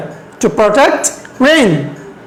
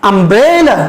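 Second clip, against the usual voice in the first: umbrella with the middle syllable stretched and rising; stress on BRE; slower than usual. Shown as a demonstration. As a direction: lean on "bre" in umbrella, then fall away. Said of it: "এখানে আমরা একটু জোর দিয়ে বলবো".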